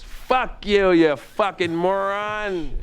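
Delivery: slow, drawn-out sneering moron; Daryl's insult. Retold or imitated. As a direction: stretch out the insult with contempt. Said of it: "Turns around and goes".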